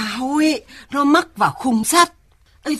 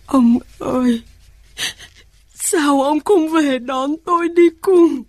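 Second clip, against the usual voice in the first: crying plea to late husband, rising higher; tearful lament; 4 semitones higher than usual. Acted. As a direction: weep as you call to him. Old mother lamenting dead husband.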